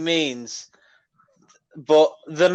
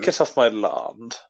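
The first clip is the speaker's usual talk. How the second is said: slow low West Country farmer; 7 semitones lower, 4 dB quieter than usual. Pretend. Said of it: "I'm a farmer".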